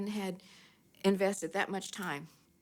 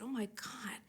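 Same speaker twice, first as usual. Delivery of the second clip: a whispered oh my God, humbled; whispering in disbelief; awed whisper; 4 semitones higher, 11 dB quieter than usual. Retold or imitated. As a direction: barely voice it, stunned. Overwhelmed by the offer.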